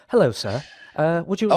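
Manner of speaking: put-on high, prim English accent, lightly done. Host mimicking Owen's English accent on a prank call. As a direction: put on a polite English waiter voice. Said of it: "he'd always affect an English accent".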